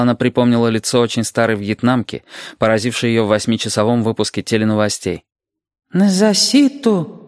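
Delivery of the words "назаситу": high, slow, drawn-out question; anxious old woman pitched well up; old Vietnamese woman. A narrator's reading. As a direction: ask plaintively in a put-on high voice. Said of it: "спрашивала старушка".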